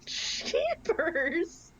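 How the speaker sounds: very high-pitched squeal; shocked protest. Real, not acted. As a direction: squeal in dismay at a friend's bluntness. Mock protest at grim remark.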